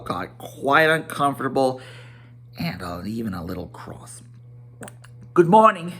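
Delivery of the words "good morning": brisk, higher hobbit greeting; a polite but curt good morning; Bilbo's voice. Acted. Bilbo dismissing the old wizard, curt and higher. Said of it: "he said at last".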